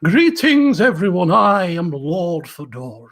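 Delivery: loud, slow, grandly theatrical nobleman; booming, pompous self-introduction to a tavern crowd; flamboyant wizard Lord Fedoran. Acted. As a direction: boom it out like an eccentric lord in a feathered hat. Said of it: "voicing a character called Fedoran".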